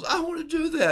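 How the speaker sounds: high, loud, eager cry; eager Peter. Retold or imitated. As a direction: jump at the chance, thrilled. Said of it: "Peter goes".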